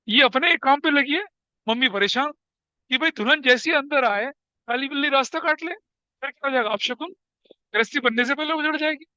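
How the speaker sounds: normal talking voice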